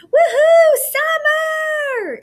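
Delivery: loud high cheer across the hall; yelled cheer. Acted. Boy cheering for his friend on stage, loud yell. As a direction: shout it as loud as you can. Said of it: "I yelled".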